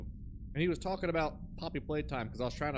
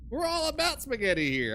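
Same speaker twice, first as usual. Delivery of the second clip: mock employee voice; 8 semitones higher, 6 dB louder than usual.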